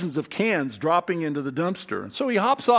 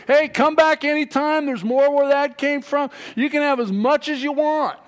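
put-on calling after the boys, higher and louder; cheery shout across the yard; friendly call-out; 8 semitones higher, 4 dB louder than usual.

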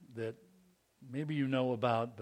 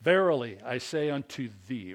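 King James proclamation; 7 dB louder than usual. Acted. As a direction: declaim it like old scripture. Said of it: "some of you from the King James".

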